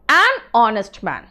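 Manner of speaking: "an honest man", each word separate and very slow; clear an before vowel. Shown as a demonstration. Teacher to students, slow clear demo of an honest man. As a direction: say "an honest man" slowly so the two vowels stay apart. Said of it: "ये दो अलग लग रहा है".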